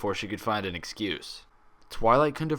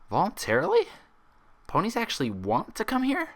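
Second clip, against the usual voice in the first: much higher, incredulous; can't believe it; astonished question; 13 semitones higher than usual. Acted. Twilight amazed patients come willingly, high incredulous question.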